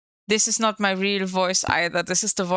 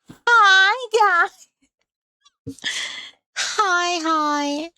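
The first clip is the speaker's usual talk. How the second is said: joke real voice; 7 semitones higher than usual. Pretend. Streamer fakes a real voice for chat, squeaky high voice. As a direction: chirp it like a cartoon girl. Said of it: "do you wanna hear my, my real voice?".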